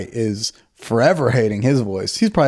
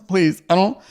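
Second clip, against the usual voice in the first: high-pitched, reluctant begging; mock pleading; 8 semitones higher than usual. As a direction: act out a humiliated man begging off. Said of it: "He's like".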